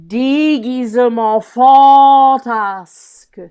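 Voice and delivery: loud, slow Déguisement fantasque with fan- held very long; drawn-out French, leaning on dé- of Déguisement and fan- of fantasque; insistance. Shown as a demonstration. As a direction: swell hugely on the first syllable of fantasque. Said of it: "what a great insistance".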